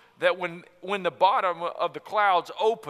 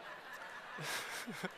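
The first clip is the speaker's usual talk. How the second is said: breathy laugh; 3 semitones higher, 16 dB quieter than usual. Real, not acted. Laughing at parents' book.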